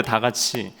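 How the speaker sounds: ordinary talk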